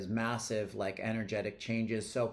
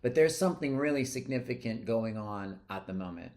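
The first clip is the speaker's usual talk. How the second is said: ordinary talk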